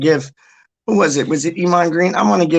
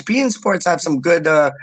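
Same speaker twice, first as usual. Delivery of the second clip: ordinary talk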